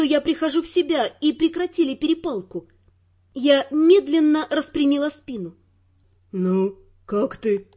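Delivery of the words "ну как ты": slow, lower male voice, concerned; worried concern. Acted. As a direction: ask gently and slowly. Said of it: "с беспокойством заглядывая мне в глаза".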